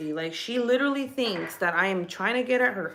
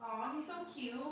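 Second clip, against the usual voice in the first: high, soft cooing; gentle, lifted parent voice; 9 semitones higher, 12 dB quieter than usual. Real, not acted. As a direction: coo sweetly to a little one. Aside to child watching a cartoon.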